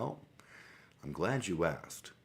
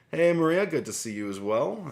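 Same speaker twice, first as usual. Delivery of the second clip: bright, much higher and louder hello to a viewer; excited greeting; 11 semitones higher, 9 dB louder than usual. Real, not acted. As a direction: call out a happy welcome to a chat regular.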